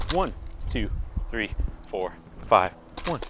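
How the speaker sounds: rhythmic counting with footwork, stressing five. Shown as a demonstration. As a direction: keep the counting steady as you move in and swing. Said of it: "what I'm gonna do is just go move to the ball".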